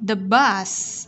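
BUS ending in a hissed S; final S sound. Shown as a demonstration. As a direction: hold a clear S ending "bus". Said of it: "S ending sound".

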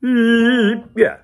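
high, loud sung yeah; a bright sung yeah; mock-casual yeah. Shown as a demonstration. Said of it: "We think".

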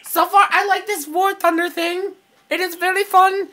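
high, monotone alien explaining; Conehead. Acted. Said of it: "I'm gonna do my Conehead impression".

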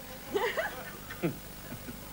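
a high, airy laugh with no voice; breathy giggle. Real, not acted. Host giggling after teasing Cheryl about needing help. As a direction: giggle at your own teasing remark.

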